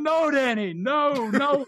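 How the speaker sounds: high, pleading mock yell; retold scream. Teller begging his friend to stop, retold scream. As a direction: re-enact frantic calling without full volume. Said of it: "I'm screaming just as loud".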